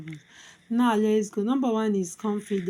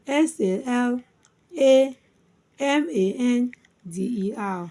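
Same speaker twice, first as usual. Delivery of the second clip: spelled by syllables; 4 dB louder than usual. Shown as a demonstration. Teacher spelling salamander for learners, syllable by syllable. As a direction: punch the lone A between letter groups. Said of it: "Let's spell it again".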